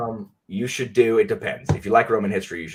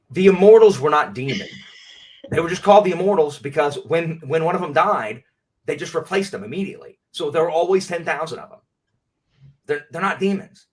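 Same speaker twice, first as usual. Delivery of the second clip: much louder, firm and insistent; real annoyed rant; 7 dB louder than usual. Real, not acted. Streamer correcting a movie's myth to viewers, loud insistent rant. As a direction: set the record straight, hammering it home. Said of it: "Such a stupid movie".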